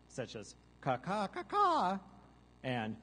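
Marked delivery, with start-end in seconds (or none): 0.8-2.0 high gull caws rising to a loud shriek; quick caws climbing very high; seagull distress cry. Shown as a demonstration. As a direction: imitate an alarmed gull. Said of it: "a couple of distress cries".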